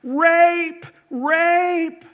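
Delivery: loud, high cry of alarm, drawn out; acted scream. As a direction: imitate a woman crying out at full volume. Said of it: "begins to scream at the top of her lungs".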